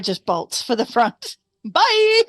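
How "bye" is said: ordinary delivery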